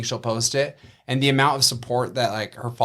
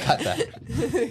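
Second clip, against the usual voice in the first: laughing and pitched up; 11 semitones higher than usual. Real, not acted. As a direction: talk through laughter. Laughing after joke.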